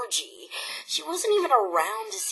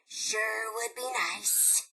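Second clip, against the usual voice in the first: muttered aside; 2 semitones lower, 12 dB quieter, slower than usual. Acted. Muttered repeat of her nag.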